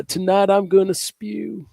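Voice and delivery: high, slow, laughing Trump voice; hammed-up Trump impression. Doing Trump sex talk impression.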